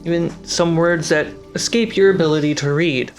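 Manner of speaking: usual speaking voice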